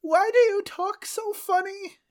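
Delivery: very high, put-on old-world voice; medieval peasant voice. Man from the past questions a stranger, very high put-on voice. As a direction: squeak it like a frightened peasant from the past. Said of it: "I'm the one that has to do the accent".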